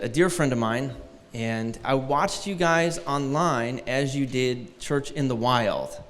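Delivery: ordinary delivery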